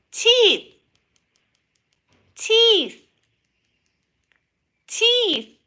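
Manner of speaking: very high, loud nonsense calls; silly high voice. Pretend. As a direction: chant body parts in a squeaky voice. Weird word-calling face bit.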